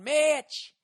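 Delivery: high, stretched two-syllable call across the arena; high, loud, drawn-out Mitch; mocking drawn-out yell. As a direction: imitate Dallas girls calling out Mitch. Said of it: "They were going".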